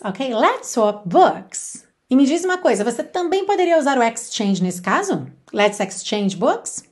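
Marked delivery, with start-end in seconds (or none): 0.3-1.9 slow, even repeat with falling end. Shown as a demonstration. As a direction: say it again slowly for learners to copy. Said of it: "como é que você diria?". (repetição lenta, entonação descendente)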